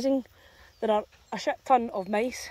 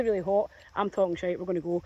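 usual speaking voice

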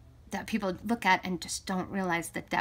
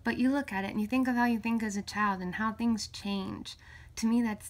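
normal talking voice

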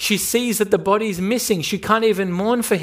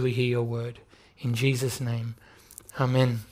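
prayer; 3 semitones lower than usual. Acted. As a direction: pray earnestly and gently to the Father. Leads a prayer before sermon.

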